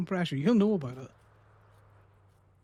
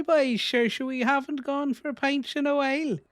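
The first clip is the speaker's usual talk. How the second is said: slow, higher, broad Northern Irish drawl; thickened Northern Irish accent; 7 semitones higher, slower than usual. Shown as a demonstration. Playing up his accent to baffle friends, slow broad Northern Irish. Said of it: "I can really thicken it up".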